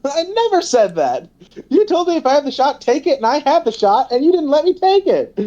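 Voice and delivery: high, exasperated mock Caitlyn with a laugh; indignant Caitlyn impression. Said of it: "and she's like".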